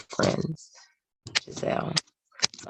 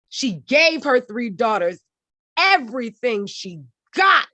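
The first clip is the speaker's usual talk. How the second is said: high-pitched, emphatic slam poem delivery; rhythmic declaiming, voice lifted well above normal speech; spoken word poetry; 7 semitones higher, 4 dB louder than usual. Acted. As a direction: perform it like a slam poem, punching each refrain. Performs her poem.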